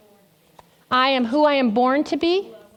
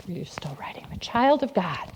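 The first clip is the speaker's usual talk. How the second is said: whispered aside; 7 semitones lower, 9 dB quieter than usual. Real, not acted. Aside to the scribe while writing.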